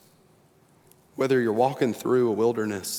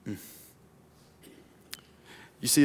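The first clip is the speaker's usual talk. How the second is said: ordinary delivery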